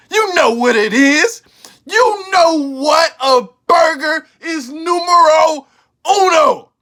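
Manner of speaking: loud, emphatic rant, slowing down to hammer each point; hyped shouting. Real, not acted. Vlogger crowning Whataburger to his viewers, loud and emphatic. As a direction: shout it like a proud Texan preaching to the crowd. Hyped-up ranking of favourite burger.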